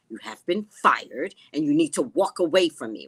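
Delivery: forceful acted-out telling-off; retold firm dismissal. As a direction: replay ordering someone away, slowing on FIRED.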